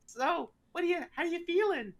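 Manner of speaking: high-pitched cartoonish harlequin voice; Harley Quinn knockoff. Harlequin scare actor toying with bound visitor, high mock character voice. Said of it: "She goes".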